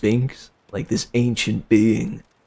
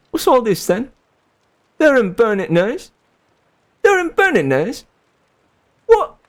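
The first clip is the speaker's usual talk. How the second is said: British accent; 12 semitones higher than usual. Shown as a demonstration. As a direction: play a cheery British policeman, pitched way up. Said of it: "try my name in a British accent".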